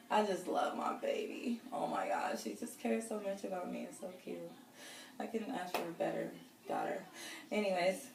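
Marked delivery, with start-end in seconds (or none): 1.7-2.4 whispered, much lower and softer; emotional whisper. Real, not acted. Mom gushing about her daughter, whispered. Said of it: "I'm gonna start crying". 2.4-7.0 much softer, almost fading away; tearful, soft. Real, not acted. Mom praising her caring daughter, soft and near tears. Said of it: "I'm gonna start crying".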